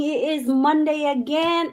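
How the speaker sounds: ordinary talk, nothing marked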